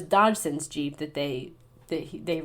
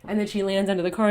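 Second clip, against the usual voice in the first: ordinary talk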